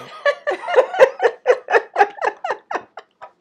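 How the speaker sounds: loud, high, real delighted laughter; high shrieking laugh. Real, not acted. Friend cracks up at the emotional moment.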